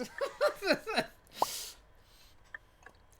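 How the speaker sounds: high giggling burst of laughter; delighted laugh. Real, not acted. Laughs at romantic moment.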